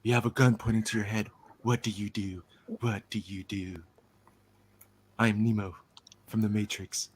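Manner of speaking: a hint of the movie star's delivery; Keanu Reeves. Showing an actor who never changes, light Keanu impression. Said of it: "the Keanu Reeves of accents".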